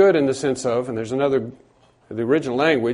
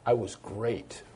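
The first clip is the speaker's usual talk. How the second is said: deadpan boast; 3 semitones lower, 11 dB quieter, slower than usual. Retold or imitated. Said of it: "I said".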